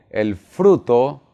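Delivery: slow, drawn-out prompt to repeat; leading a repeat-after-me. Shown as a demonstration. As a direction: stretch it out for the audience to echo. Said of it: "diga conmigo".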